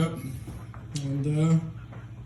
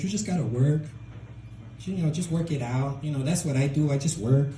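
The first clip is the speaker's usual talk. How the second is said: put-on Mexican accent, lifting phrase ends; dad's Mexican accent. Immigrant dad on his son's breakup, Mexican accent. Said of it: "my dad totally sounds like that".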